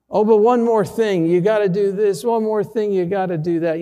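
urgent, high, loud rush of orders; frantic instructions. Retold or imitated. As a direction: fire off last-minute orders in a panic. Retelling frantic orders to staff.

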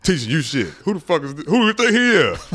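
puffed-up ego demanding who this dude is; boisterous ego voice. Acted. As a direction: play the outraged ego, rising to a high, loud challenge. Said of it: "That's how my ego talks".

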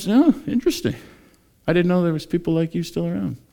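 high-pitched, acted amazement; curious surprise. Passerby meeting Christians still around, curious surprise. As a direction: react as to a rare find. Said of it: "somebody said that to somebody".

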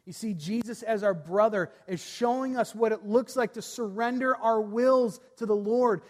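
ordinary delivery

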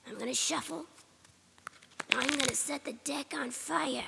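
ordinary talk, nothing marked

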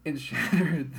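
loud, screamed word; horror movie scream. Acted. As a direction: scream it like a monster. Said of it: "Do you like my scream?".

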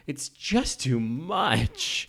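slow mock tremble of the line; shaky breathy line reading. Host imitates Meryl's shaky line, slow and high. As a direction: act it out slowly after a breath. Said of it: "gives a shaky, deep breath and goes".

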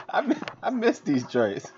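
high, laughing through the words. Real, not acted. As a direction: giggle it out. Laughing while speaking.

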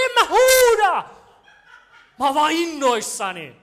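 a grinning shout of pure excitement; excited shouting. Retold or imitated. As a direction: yell back that you're not yelling, laughing. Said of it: "miksi sä huudat".

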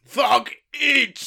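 loud, halting caveman grunts; caveman speak. Acted. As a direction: bark it out like a dim caveman, word by word. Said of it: "is it caveman speak now?".

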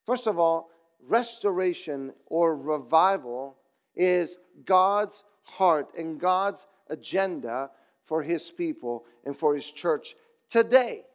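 ordinary talk, nothing marked

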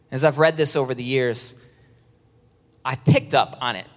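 ordinary talk, nothing marked

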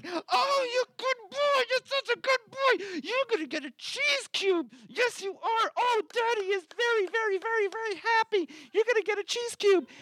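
put-on very high excited baby talk to a dog; squealing puppy praise. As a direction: gush over the good boy. Praising dog after it poos.

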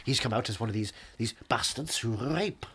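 ordinary talk, nothing marked

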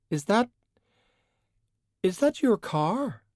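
sudden high, startled question; surprised. A narrator's reading. Valkyrie eyeing the car in the yard, surprised. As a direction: catch sight of something odd and blurt it.

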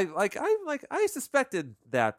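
ordinary delivery

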